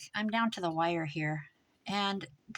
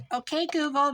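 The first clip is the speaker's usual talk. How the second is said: loud, higher call to the speaker; calling the assistant; 7 semitones higher, 6 dB louder than usual. Retold or imitated. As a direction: call out to the Google device.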